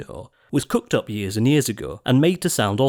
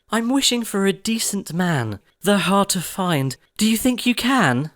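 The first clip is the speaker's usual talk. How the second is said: wiser Cinderella; 7 semitones higher than usual. A narrator's reading. Cindy answering the fairy, high and thoughtful. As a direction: answer like a girl who learned.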